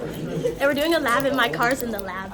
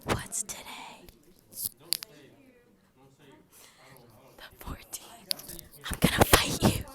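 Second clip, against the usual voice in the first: frantic whisper; 10 semitones lower, 6 dB quieter, slower than usual. Pretend. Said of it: "It's an A S M R".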